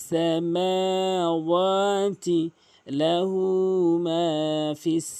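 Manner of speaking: slow, melodic recitation; Quran recitation. Shown as a demonstration. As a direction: chant it slowly, drawing out each vowel.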